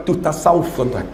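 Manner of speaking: ordinary talk, nothing marked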